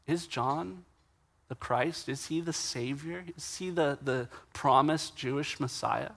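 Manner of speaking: soft, tentative questions; voicing a crowd's quiet speculation; hushed wondering. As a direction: wonder it under your breath, halting. Voicing the people's private wondering.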